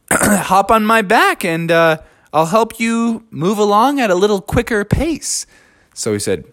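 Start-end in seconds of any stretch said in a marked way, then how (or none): 0.1-5.5 higher, eager bear voice; friendly bear. Acted. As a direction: offer the ride cheerfully.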